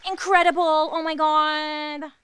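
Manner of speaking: hammed-up terrified praise; fake awe. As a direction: drag out the forced wonder. Forced applause impression.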